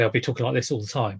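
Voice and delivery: rapid quick delivery; talking fast. Shown as a demonstration. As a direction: rattle it off quickly. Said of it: "If I talk quickly".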